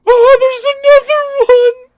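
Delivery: loud, high panicked yell; startled shout. Real, not acted. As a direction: shriek at the sudden monster. Startled by a game enemy.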